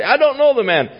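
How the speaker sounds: furious shout of denial; cursing denial. Acted. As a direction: spit out the denial with a curse. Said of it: "began to curse and swear".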